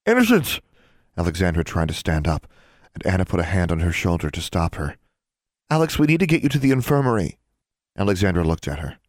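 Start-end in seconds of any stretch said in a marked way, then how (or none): 0.1-0.6 sudden high shocked cry; alarmed cry. A narrator's reading. 5.7-7.4 urgent. A narrator's reading. Anna worried.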